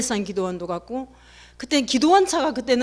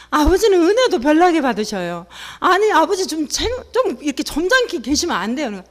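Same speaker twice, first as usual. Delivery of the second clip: embarrassed scolding; 5 semitones higher, 5 dB louder than usual. Retold or imitated. Daughter scolding father, retold.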